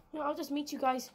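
ordinary delivery

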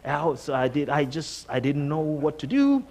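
ordinary delivery

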